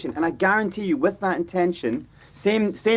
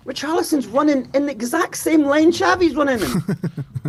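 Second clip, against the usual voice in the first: higher, laughing through the line; laughing disbelief; 8 semitones higher than usual. Real, not acted. Telling co-hosts about a bad run, laughing. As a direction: laugh as you describe the blunder.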